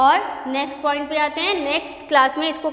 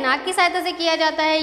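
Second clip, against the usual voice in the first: quick explanation; faster than usual. Shown as a demonstration. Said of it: "इसका उच्चारण क्या है".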